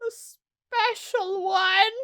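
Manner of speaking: very slow, high guilty whisper; dreadful admission. Acted. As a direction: drag it out dreading. Cook dreading the answer.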